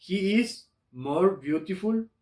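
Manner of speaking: slow English example, rising at the end; slow model sentence. Shown as a demonstration. Teacher models the comparative sentence, slow and rising. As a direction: draw it out for the students. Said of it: "voy a decir que".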